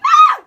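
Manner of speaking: high, loud cry of shock; pained shout. Real, not acted. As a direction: yelp as the heat hits. Spicy bite.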